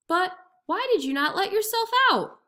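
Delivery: spring the trap gently; innocent jackal. A narrator's reading.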